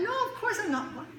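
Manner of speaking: very high, gushing, drawn-out cheer; exaggerated smiling reassurance. Parent convincing kid they're not mad, hammed-up happy. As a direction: oversell happiness with a big audible smile. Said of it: "you gotta smile as soon as they ask".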